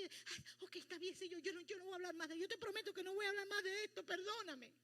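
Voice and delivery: frightened apology. Retold or imitated. Preacher startled after God's rebuke, frightened pleading. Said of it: "Yo me asusté".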